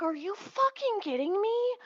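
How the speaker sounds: incredulous. Retold or imitated. Retelling her reaction to the officer, high incredulous. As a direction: squeak out your outrage, high. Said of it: "I'm like".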